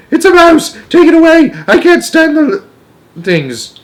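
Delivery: very high, loud panicked shrieks; hysterical yelling. A narrator's reading. Mother on a chair at sight of mouse, hysterical yelling.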